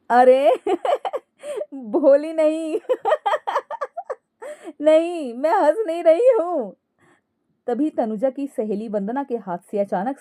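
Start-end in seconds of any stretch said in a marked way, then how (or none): 0.1-6.8 high cackles breaking up the words; laughing denial. Acted. Daughter-in-law protesting to the guests, laughing helplessly. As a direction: insist you are not laughing, while laughing. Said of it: "Ankita हंसते-हंसते ही बोली".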